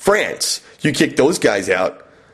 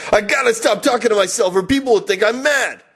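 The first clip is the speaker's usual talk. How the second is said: pitched-up mock outburst at himself; mock shout; 9 semitones higher than usual. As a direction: blurt it like a man catching himself ranting. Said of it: "It ended when I shouted".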